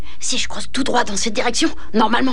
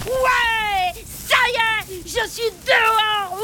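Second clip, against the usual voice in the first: excited; 7 semitones higher, much slower than usual. Acted. Sort enfin du terrier.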